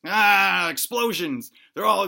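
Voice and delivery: normal talking voice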